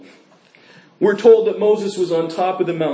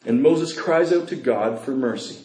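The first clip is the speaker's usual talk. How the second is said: ordinary delivery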